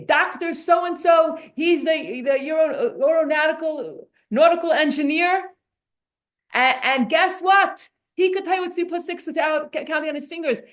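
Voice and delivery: hammed-up proud host showing off a speaker; grand mock introduction. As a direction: introduce a guest as if it's a great honor. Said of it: "to introduce him and say".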